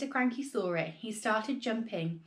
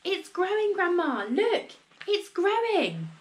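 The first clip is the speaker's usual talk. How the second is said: high, loud yell; excited yell; 9 semitones higher, 4 dB louder, slower than usual. A narrator's reading. George yelling to Grandma as the hen grows, excited high yell. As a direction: yell the news upward. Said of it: "he yelled".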